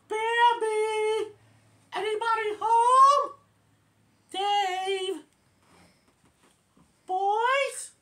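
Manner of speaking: put-on very high, loud old lady calling out; Miss Miller shouting. Miss Miller arriving and calling for everyone, high and loud. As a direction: holler shrilly from the doorway. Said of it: "Miss Miller shouts".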